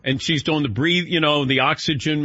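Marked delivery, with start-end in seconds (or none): none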